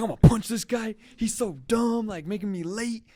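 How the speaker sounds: hammed-up angry yell about the coworker; mock furious rant. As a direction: blow up at the dumb coworker, overdone. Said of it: "thinking really rude thoughts".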